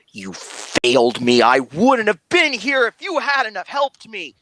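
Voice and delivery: furious outburst rising high and loud; enraged father. Acted. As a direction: hurl the blame at the angel in rage. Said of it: "the look on your father's face is livid".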